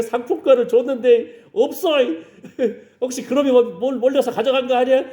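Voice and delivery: high-pitched acted fretting with a chuckle; mock suspicious complaint. Church lady suspecting someone took her vouchers, mock suspicious. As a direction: play the suspicious accuser, high and amused.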